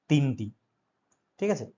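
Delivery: ordinary talk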